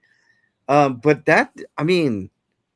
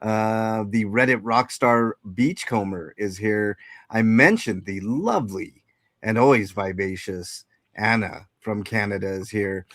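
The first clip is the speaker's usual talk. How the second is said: ordinary talk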